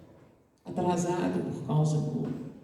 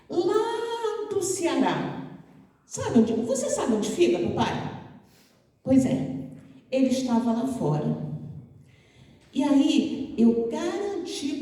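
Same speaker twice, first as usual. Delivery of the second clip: put-on much higher, sing-song child talking to her father; sweet little girl; 5 semitones higher than usual. The little girl introduces her new friend to her father, high and sing-song. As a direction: plead sweetly like a proud daughter.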